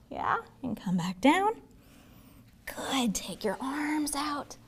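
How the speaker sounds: soft slow whisper. Real, not acted. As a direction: hush it down gently. Calm yoga instruction, whispered.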